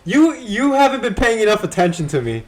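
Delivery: loud, high pretend complaint; mock nagging. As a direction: complain as an imagined neglected wife. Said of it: "how does she, like, nag him?".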